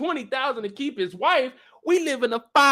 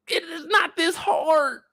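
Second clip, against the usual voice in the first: slow, higher, pleading exasperation; exasperated whine; 7 semitones higher, slower than usual. Real, not acted. Venting at Phil for wasting money, exasperated whine. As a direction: near tears of frustration.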